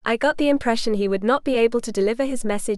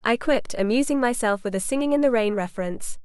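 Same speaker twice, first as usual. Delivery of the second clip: plain reading voice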